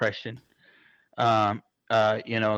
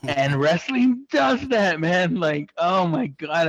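choked-up excitement; 6 semitones higher, 3 dB louder, slower than usual. Real, not acted. Overcome while retelling a moving moment.

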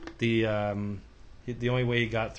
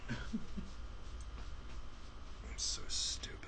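whispered; 9 semitones higher, 15 dB quieter than usual. Real, not acted. Mistake with the ad.

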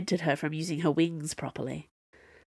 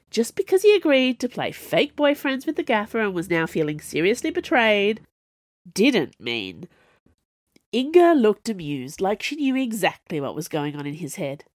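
ordinary delivery, nothing marked

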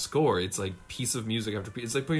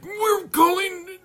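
very high, loud, slow, strained; mocking the ship captain's strained voice; strained alien woman voice; 7 dB louder, much slower than usual. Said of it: "the woman on the ship talks like this".

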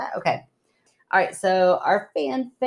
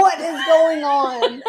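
higher and slow, with a laugh in it; confused alarm; 8 semitones higher, 3 dB louder, slower than usual. Retold or imitated. Co-host imagining the husband waking to screams, bewildered and laughing. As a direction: act out waking up totally lost. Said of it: "you're like".

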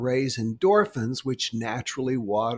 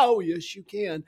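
laughing while talking; 5 semitones higher, 3 dB quieter than usual. Shown as a demonstration. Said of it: "Keep laughing".

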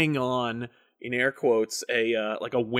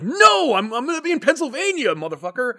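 defiant director; 10 semitones higher than usual. Retold or imitated. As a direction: plant your flag and refuse to move. Impersonating Shyamalan defiant.

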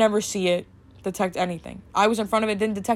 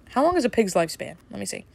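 ordinary talk

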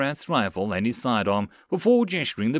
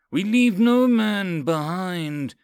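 high, slow, drawn-out rallying yell; 7 semitones higher, 3 dB louder, slower than usual. Acted. Lieutenant rallying troops to stay, yelling. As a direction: proclaim it to the whole platoon. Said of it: "Karath yelled again".

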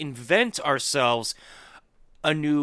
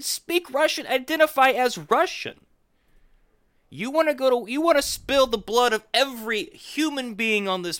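heated rant; 8 semitones higher than usual. Real, not acted.

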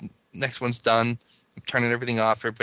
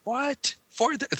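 much higher incredulous "What? Four thirty?"; shocked wife. Retold or imitated. Wife woken at night hearing the time, incredulous. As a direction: jolt awake in disbelief. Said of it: "she's getting mad".

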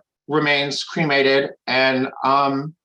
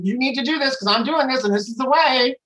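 high-pitched, preachy nagging; mock fussing; 8 semitones higher than usual. Big sister telling baby sister what to do, mock fussing. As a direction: imitate yourself fussing at someone, pitched up. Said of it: "like, fuss at her about".

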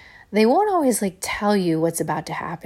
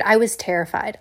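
ordinary delivery

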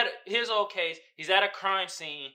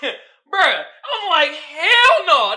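laughing disbelief; 9 semitones higher, 13 dB louder, much slower than usual. Real, not acted. Reacting to old Denzel climbing.

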